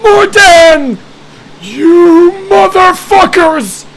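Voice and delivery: furious mummy shriek, high and loud; dusty mummy rasp. Acted. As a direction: scream it like an enraged undead. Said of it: "And the mummy says".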